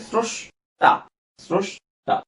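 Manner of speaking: স্রষ্টা split slowly as "srosh-ta"; s at the start of স্রষ্টা, sh before the ta; pronunciation demonstration. Shown as a demonstration. Teacher sounding out স্রষ্টা for students in two slow parts. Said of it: "আমি দেখিয়েছি স্রস".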